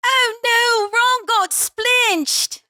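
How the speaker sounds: very high, drawn-out mocking voice; Hermione impression. Guest copying the host's meme line, exaggerated Hermione. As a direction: squeal it slowly as a hammed-up film heroine. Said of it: "Hermione's like".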